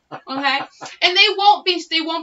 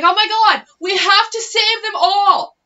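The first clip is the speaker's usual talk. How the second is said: mock Nancy Pelosi; 5 semitones higher, 5 dB louder than usual. Podcaster mocking a politician's plea, hammed-up high and loud. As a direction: ham up a fretting politician, higher and louder. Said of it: "She's like".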